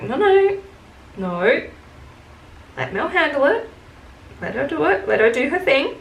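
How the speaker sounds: self-restraining. Retold or imitated. Worker holding back so Mel handles it, high-pitched restraint.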